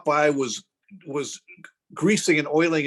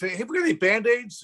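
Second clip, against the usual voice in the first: retold yell; 8 semitones higher than usual. Tattooist calling to his wife, mild retold yell. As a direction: call out a question as if across the room. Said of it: "he yelled to his wife".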